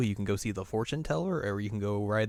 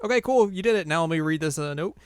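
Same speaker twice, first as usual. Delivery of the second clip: higher, louder pep; upbeat retold quote; 8 semitones higher, 7 dB louder than usual. Podcaster voicing Cassia moving on to a note, upbeat. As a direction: toss it out loud and high, voicing a character. Retelling game character Cassia's lines.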